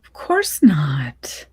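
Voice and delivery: slow, whispered reassurance; a mother whispering comfort to her daughter. Acted. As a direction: hush her fears slowly, in a whisper.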